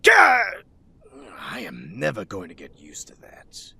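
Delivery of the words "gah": sudden high, loud yelp of fright; startled yelp. Acted. Caught off guard by a vigilante's sudden appearance, startled yelp. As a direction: yelp "gah" as if jumped.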